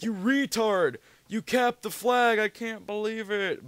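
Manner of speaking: high, loud mock rage at a teammate; imitating a furious scream. Friend screaming at the teammate who capped the flag, high loud mock rage. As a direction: screech it like a friend losing it over a game. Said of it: "Oh, he was screaming his ass off".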